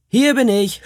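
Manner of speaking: viel höher und lauter, eifrig herausgerufen; laut rufen, voller Eifer; Schrei. Acted. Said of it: "schrie ich". (Junge meldet sich eilig beim Krieger, laut schreiend)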